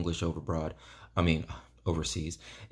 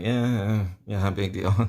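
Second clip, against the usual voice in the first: mock nonchalance; 3 semitones higher, 5 dB louder than usual. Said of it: "they're just kinda like".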